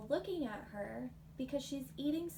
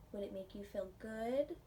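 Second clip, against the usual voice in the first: ordinary delivery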